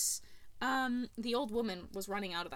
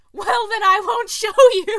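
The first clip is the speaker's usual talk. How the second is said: much higher and louder, breaking into laughter; laughing; 9 semitones higher, 17 dB louder than usual. Retold or imitated. Darby teases the priest about the bag, laughing. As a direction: blurt the punchline while cracking up.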